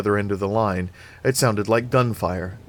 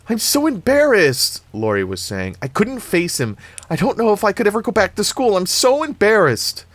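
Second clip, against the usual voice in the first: mortified; 11 semitones higher, 5 dB louder than usual. A narrator's reading. Laurie mortified on the phone.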